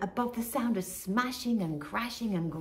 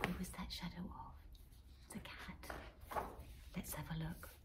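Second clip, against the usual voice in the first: soft whisper pointing out a hidden danger; hushed whisper; 3 semitones lower, 15 dB quieter than usual. Real, not acted. Narrator whispers to children about the lurking cat. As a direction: whisper so the cat won't hear. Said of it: "narrator hushes to spot the cat".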